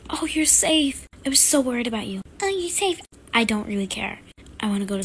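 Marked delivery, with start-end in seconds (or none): 0.1-1.1 relieved gasp. Acted. Wife sees husband home. 2.4-3.0 much higher squeal of relief; overjoyed relief. Acted. Wife repeats in relief.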